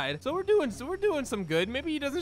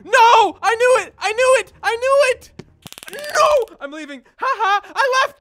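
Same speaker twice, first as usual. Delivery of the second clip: high, loud frantic shouts; 12 semitones higher, 11 dB louder than usual. Real, not acted. Gamer fleeing the cop, high frantic shouting. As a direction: yell it as you flee.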